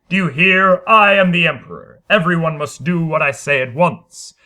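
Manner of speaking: loud, imperious orders; commanding shout. Acted. Harrison ordering the cowering studio, loud and imperious. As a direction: demand obedience at once.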